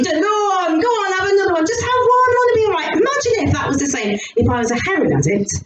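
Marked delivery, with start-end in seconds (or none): 0.0-2.9 high, nagging peer pressure; pressuring friend. Retold or imitated. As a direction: imitate a pushy drinker coaxing a friend. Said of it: "they have a go at you".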